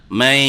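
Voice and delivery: মাই held with a hum through the nose; মাই with a lingering trace of n; nasal pull. Shown as a demonstration. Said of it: "নাকে টান দিয়ে পড়ছেন".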